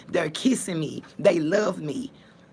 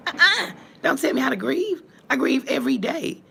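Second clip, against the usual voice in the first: sharp, higher and louder pushback; indignant retort; 7 semitones higher, 3 dB louder than usual. Real, not acted. To people judging her grief, indignant. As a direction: tell the critics off, no nonsense. Answering critics of her grieving.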